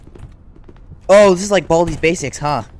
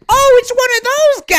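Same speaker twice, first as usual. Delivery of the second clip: startled squeal; 6 dB louder than usual. Real, not acted. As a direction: squeal at the sight of it. Spots a scary monster.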